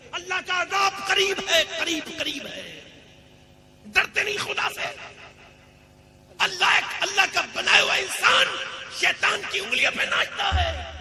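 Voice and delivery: high, loud shouted challenge to the congregation; full-force preaching. Real, not acted. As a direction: thunder the questions at the crowd. (پورے جوش سے بلند آواز میں للکار)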